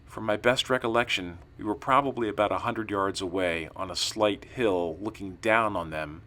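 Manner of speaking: usual speaking voice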